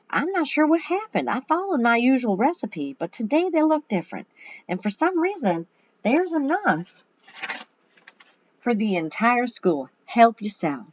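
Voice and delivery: high-pitched lady's character voice; puzzled but hospitable, pitched well up; puzzled cookie lady. A narrator's reading. Missus Rosenblum explaining odd cookies to school, high puzzled voice.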